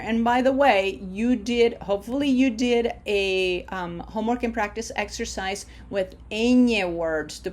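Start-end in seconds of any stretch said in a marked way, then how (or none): none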